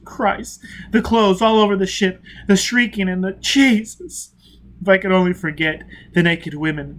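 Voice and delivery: high, loud, rising to anguish; haunted confession. A narrator's reading. Prisoner reliving the massacre for Foyle, anguished. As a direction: relive the horror.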